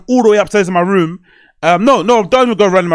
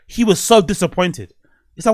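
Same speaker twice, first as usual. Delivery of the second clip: ordinary talk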